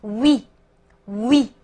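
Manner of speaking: oui as "oo" sliding quickly into "ee"; quick glide. Shown as a demonstration. Teacher showing two vowels joined, gliding oui. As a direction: glide oui into one syllable, "wee". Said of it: "很快的滑动过去".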